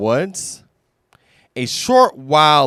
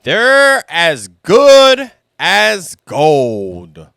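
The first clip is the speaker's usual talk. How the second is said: slow, much louder proclamation; grand slow Al; 5 semitones higher, 10 dB louder, slower than usual. Acted. Al telling friends his uncle's saying, grand and slow. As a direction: declare it grandly and slowly. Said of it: "replied Al".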